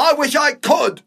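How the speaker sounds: very high, loud, drawn-out yell; exasperated shout. Acted. Older man desperate to get away, exasperated shout. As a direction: explode with frustration. Quarrel climax.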